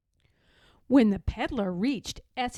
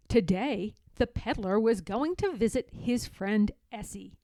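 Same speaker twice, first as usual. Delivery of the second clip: ordinary delivery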